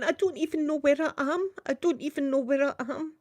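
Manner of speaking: a retold weepy plea; imitating mum crying. Her dazed mum in the street, imitated crying. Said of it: "crying, going".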